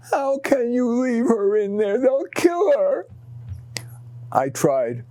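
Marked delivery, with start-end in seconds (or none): 0.1-3.1 put-on higher, desperate young girl's plea, slowing on the last words; a child begging not to leave the dog behind; pleading daughter. Young daughter begs father to save the dog, high pleading. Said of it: "and Emily pleaded".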